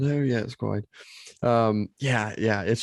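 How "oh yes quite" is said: put-on posh British English; posh British accent. Shown as a demonstration. Co-host showing off the general British sound, posh British accent. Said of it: "the posh British accent".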